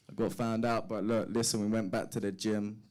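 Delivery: usual speaking voice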